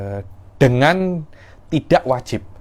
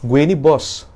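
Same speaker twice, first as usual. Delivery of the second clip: bossy demonstration, pitched up; superior commanding tone; 4 semitones higher, 4 dB louder than usual. Shown as a demonstration. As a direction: pull rank like the boss. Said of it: "isti'la, intonasinya".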